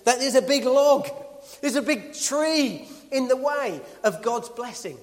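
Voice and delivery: impassioned pulpit climax; full-force preaching. Acted. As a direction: hammer each phrase to the congregation. Preaching climax about obstacles.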